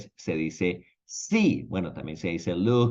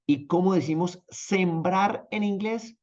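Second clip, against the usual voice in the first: ordinary delivery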